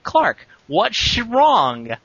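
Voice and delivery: very slow, strained through gritted teeth; through clenched teeth. Acted. Chloe asking Clark through clenched teeth. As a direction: grit your teeth and force it out. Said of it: "said through clenched teeth".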